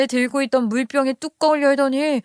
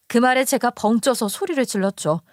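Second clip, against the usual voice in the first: ordinary delivery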